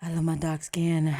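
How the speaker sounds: low purring sultry line. Pretend. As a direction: purr it like a cat. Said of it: "about to do a little tiger".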